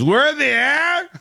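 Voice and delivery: put-on high, incredulous question from a drunk passenger; mock a confused drunk who can't believe he's flying; imitating the drunk guy, high and baffled; drunk disbelief. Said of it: "And the guy goes".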